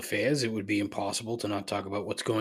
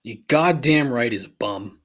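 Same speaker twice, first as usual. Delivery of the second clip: loud goddamn right; loudly agreeing he's a bum; emphatic agreement; 8 dB louder than usual. Real, not acted. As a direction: bark the agreement.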